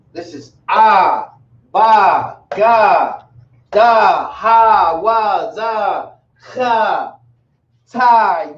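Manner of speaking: each syllable said slowly and distinctly, "ah, ba, ga, da"; reciting alphabet sounds. Shown as a demonstration. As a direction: sound out every letter for the class. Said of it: "I'll go over the sounds again".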